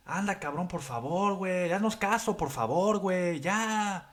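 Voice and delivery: high, soft pleading Mexican Spanish voice; Mexican friends pleading. Retold or imitated. Mexican friends begging the drunk guy to calm down, high soft pleading voice. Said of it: "they're like".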